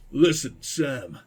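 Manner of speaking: put-on half-hearted gruff old survivalist. Streamer voicing the prepper, lightly gruff. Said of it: "I'm doing the gruff voice".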